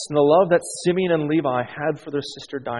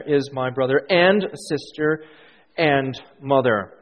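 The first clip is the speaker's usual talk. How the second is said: each "and" stretched and set apart; stress on AND. Shown as a demonstration. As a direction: hit "and" before "sister", pause, then a slow "and" before "mother". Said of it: "I emphasize where the, the word "and".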